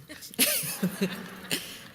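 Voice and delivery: airy voiceless chuckle; breathy laugh. Real, not acted. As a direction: laugh at the cheap hotel. Laughs at truck-stop hotel.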